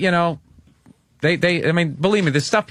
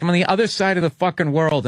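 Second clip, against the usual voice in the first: ordinary talk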